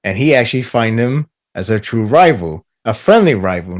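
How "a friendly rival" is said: normal talking voice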